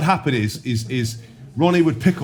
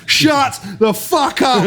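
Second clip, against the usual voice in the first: mock yell; 13 semitones higher, 5 dB louder, much slower than usual. Senior players beating him.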